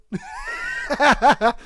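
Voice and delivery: high cackling laugh; real laughter. Real, not acted. Host amused by his Scottish bit, real high laughter. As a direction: burst out laughing at your own bit.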